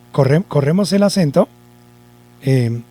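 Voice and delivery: ordinary delivery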